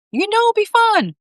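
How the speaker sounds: high eager voice; Bob voice. A narrator's reading. Bob's voice.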